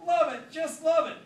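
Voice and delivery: loud, high, slow and gushing; Ringo answering Charlie, loud gushing; enthusiastic shout. Acted. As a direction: gush it across the room. Ringo calling back.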